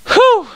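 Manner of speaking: short, very high "whew"; high whoop. Real, not acted. Player relieved to be home, high whoop.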